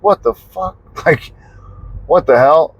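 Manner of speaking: high-pitched, incredulous reaction; stunned disbelief. Retold or imitated. Son hearing of dad's accident, high stunned disbelief. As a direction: retell your shock with a laugh breaking in.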